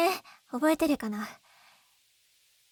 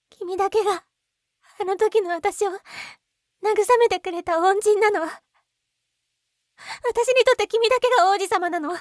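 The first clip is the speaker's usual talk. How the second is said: higher and louder, voice swelling with feeling; passionate outcry; 6 semitones higher, 8 dB louder than usual. Acted. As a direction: pour out your love at full strength. Passionate confession to her savior.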